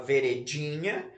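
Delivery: "veredinha" stressed on the third syllable, ve-re-DI-nha, rising. Shown as a demonstration. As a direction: lean on DI in "veredinha", as a question. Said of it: "é a penúltima sílaba". ("veredinha" com força no DI)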